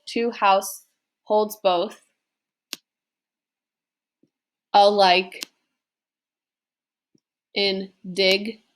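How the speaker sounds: "To house, holds both, alike, in dig-nity" in pairs with long pauses; two syllables at a time. Shown as a demonstration. Teacher marks out iambs, saying the line haltingly two syllables at a time. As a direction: break the line into separate iambs, stopping after each. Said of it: "two syllables by two syllables".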